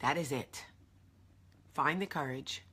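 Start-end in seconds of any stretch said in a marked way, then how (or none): none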